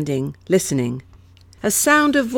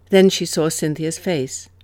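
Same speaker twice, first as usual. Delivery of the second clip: ordinary delivery, nothing marked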